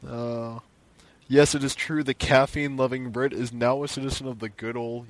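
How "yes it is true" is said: normal talking voice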